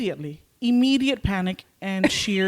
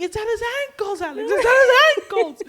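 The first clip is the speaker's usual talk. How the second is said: high-pitched cry building louder; panicked wail; 5 dB louder than usual. Retold or imitated. Retells panic to friend.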